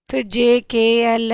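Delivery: ordinary talk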